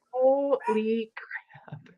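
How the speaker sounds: slow, breathless whisper of awe; whispered holy crap, dumbfounded; stunned whisper. Retold or imitated. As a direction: let it out under your breath in shock. Stunned at doctor's news.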